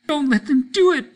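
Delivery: high, loud desperate wail; wailing child. Acted. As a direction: wail it up at the ceiling. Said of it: "Peter wailed at the ceiling".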